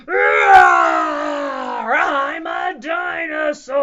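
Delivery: loud, boastful dinosaur shout; roaring dinosaur. Acted. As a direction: roar it out as an unstoppable little dinosaur.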